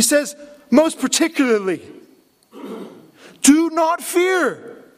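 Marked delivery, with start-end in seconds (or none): 3.4-4.8 a tearful, drawn-out cry of do not fear, up high and slow; weeping plea. Acted. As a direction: let the voice break as Joseph comforts his brothers. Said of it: "And he weeps and says".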